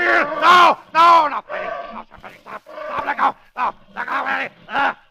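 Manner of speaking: high, loud panicked cries; a villain's dying panic; terrified screams. Acted. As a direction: scream as the gorilla grabs him. Villain attacked by gorilla.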